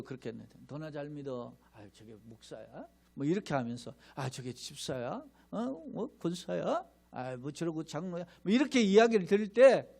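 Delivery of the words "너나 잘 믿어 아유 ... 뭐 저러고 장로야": muttered mockery. Church members mock a believer.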